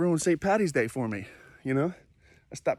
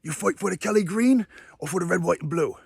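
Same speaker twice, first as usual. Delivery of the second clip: confronting in a mock British accent, raised and louder; pissed-off Brit; 7 semitones higher, 5 dB louder than usual. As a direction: imitate an angry Brit getting in someone's face. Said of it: "he's pissed at".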